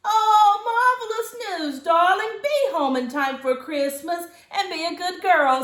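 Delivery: high, loud delighted mother; mother gushing at her daughter's news. Acted. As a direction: gush like a thrilled mom. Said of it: "said her mother".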